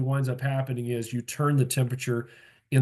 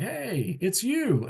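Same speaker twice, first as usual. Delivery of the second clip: happy greeting; 7 semitones higher, much slower than usual. Retold or imitated. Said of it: "walk into a room and be like".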